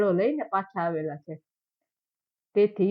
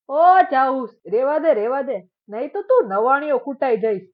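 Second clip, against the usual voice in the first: much louder, higher call across a distance; shout from afar; 4 semitones higher, 8 dB louder than usual. A narrator's reading. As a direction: bellow the warning from far off. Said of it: "છેટેથી બૂમ પાડી".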